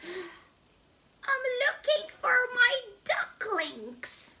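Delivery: high, slow worried goose; mother goose. Acted. The goose tells Spot she is looking for her ducklings.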